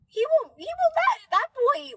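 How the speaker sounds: high squeaky cat voice for Steve; mock cat voice. Voicing her cat Steve, squeaky high cat voice.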